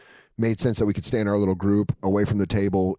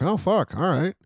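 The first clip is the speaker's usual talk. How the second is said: much higher mock awe; impressed surprise; 10 semitones higher than usual. Meeting comedians he barely knows, a much higher awed reaction. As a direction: sound starstruck and impressed. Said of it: "I'd be like".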